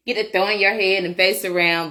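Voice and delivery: lightly acted husband's gripe; imitating husband. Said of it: "he's like".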